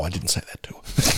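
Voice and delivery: unvoiced whisper, a quick confession; whispered aside. Real, not acted. Owning up to the co-host in a whisper. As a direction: whisper the truth behind the joke.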